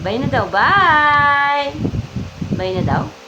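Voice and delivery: loud, long sing-out "bye"; big stretched farewell; baby-talk bye. Pretend. Prompting baby to wave bye.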